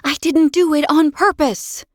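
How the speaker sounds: put-on high, pleading child's protest. A narrator's reading. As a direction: insist it was an accident. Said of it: "I protested".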